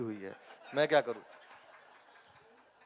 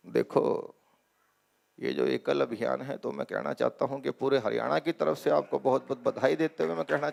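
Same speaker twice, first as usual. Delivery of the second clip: Khattar impression; 7 semitones lower than usual. Khattar congratulating the audience, in a low Haryanvi voice. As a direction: drop the voice deep and speak like a dignified minister. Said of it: "Khattar साहब को बुलाते हैं".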